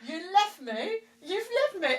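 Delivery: high, wounded accusing; hurt accusation. Retold or imitated. Wife accusing husband of leaving her, high hurt. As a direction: accuse him tearfully. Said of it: "I was like".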